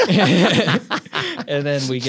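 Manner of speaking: high, slow words squeezed out through laughter; can barely get the words out for laughing; laughing hard. Real, not acted. Laughing hard while telling a friend about jail. Cracking up mid-story.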